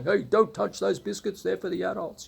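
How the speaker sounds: a hint of a cranky tea lady; mock scolding kitchen lady. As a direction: shoo the kids off the biscuits. Woman berating the little kids.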